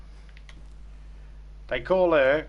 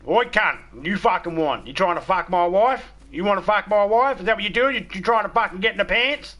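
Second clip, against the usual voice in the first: loud, raised, confrontational bellow; re-enacted booming tirade, climbing higher; big booming voice; 8 semitones higher, 5 dB louder than usual. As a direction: boom it out like an angry husband on the phone. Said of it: "my big booming voice come in".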